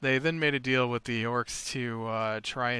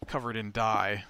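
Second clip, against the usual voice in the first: ordinary talk, nothing marked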